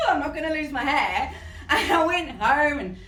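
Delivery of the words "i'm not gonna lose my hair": gleeful. Retold or imitated. Skipping out of hospital, joyful.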